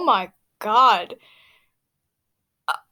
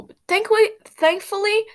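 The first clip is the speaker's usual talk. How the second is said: excited; 9 semitones higher, 3 dB louder than usual. Acted. Reader emphasizes reread.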